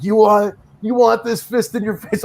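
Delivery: hammed-up serious taunt; mock-serious threat. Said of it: "was so serious, like almost like".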